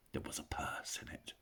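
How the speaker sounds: whisper. A narrator's reading. Narrator whispers tense moment.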